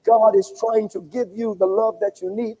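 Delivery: ordinary talk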